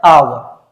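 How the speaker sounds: "आवर" with no H sound and a barely-there final R; silent H and R. Shown as a demonstration. Teacher showing students how hour is pronounced, "आवर" with silent H and R. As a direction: say "आवर" British-style, opening on the vowel, R left silent. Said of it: "इसका जो उच्चारण आता है".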